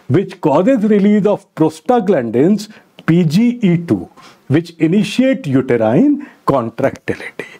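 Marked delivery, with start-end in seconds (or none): none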